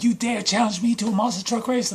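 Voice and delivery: hammed-up fighter calling out an opponent; indignant challenge. Character confronts his beaten opponent.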